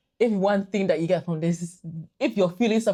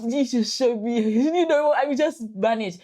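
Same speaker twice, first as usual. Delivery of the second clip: high laughing relief; 7 semitones higher, 6 dB louder, slower than usual. Retold or imitated. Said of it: "It was like".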